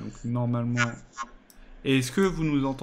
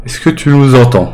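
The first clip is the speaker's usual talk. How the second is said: son saturé, micro qui crache; voix distordue par la saturation; 20 dB louder than usual. Real, not acted. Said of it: "ça sature". (micro saturé, son distordu)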